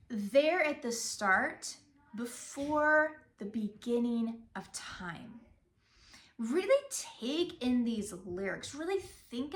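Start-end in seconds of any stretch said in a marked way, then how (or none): 0.1-5.4 slow, weighted THERE at the start; expressive inflection. Shown as a demonstration. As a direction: linger on BEFORE and beginning of time. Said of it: "feel that same type of inflection".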